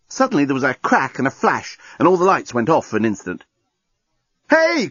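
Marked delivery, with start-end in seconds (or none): 4.5-4.9 much higher sudden yell; startled cry. A narrator's reading. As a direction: shout out as the lights die. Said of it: "cried everyone".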